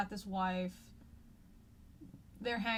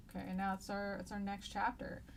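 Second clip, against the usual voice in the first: ordinary talk, nothing marked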